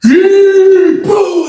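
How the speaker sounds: high whooping shout; excited yell. Retold or imitated. Surfing inmate shouting to the crowd, high excited whoop. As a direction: yell it out in triumph.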